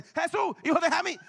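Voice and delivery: loud, high cry for mercy; shouting plea. Retold or imitated. Blind man shouting to Jesús for mercy, loud and high. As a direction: shout it over the crowd.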